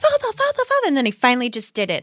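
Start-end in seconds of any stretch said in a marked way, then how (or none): 0.0-0.9 high, urgent mock plea; hammed-up, high-pitched pleading; Edward. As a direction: imitate a son calling out in falsetto. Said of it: "was like".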